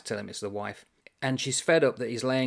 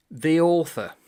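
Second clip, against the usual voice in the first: a louder, declaring answer; author's reply; 5 semitones higher, 7 dB louder than usual. Acted. The author answering his own character, loud and declaring. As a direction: announce yourself with weight.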